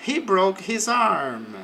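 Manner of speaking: careful teacher demo; slow example read. Shown as a demonstration. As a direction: model it slowly for learners. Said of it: "English example read slowly".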